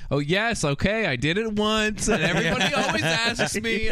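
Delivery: eager pretend answer. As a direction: pitch it up like a proud circus guy. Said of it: "it would be better if he was like".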